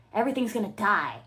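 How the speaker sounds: usual speaking voice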